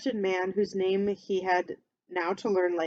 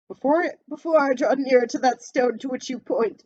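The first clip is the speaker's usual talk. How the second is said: put-on louder, pleading old miser; anxious Scrooge; 5 semitones higher, 6 dB louder than usual. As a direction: beg the spirit nervously.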